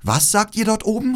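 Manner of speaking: high-pitched, mild call; rufen. A narrator's reading. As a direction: call up to the birds in a high girl's voice. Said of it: "rief Gerda". (hoch und leicht rufend)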